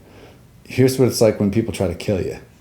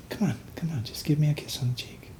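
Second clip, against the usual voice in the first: high, hushed, coaxing voice; coaxing whisper; 7 semitones higher, 11 dB quieter than usual. Retold or imitated. Imagined entity tempting the soldier, coaxing whisper. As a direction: coax softly in a raised whisper.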